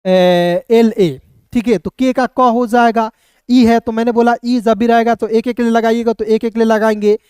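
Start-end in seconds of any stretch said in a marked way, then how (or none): 0.0-1.2 letters drawn out very slowly; slow letter spelling. Shown as a demonstration. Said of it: "मैं लिखा हूँ यहाँ पे के ई". (बहुत धीरे अक्षर बोलना)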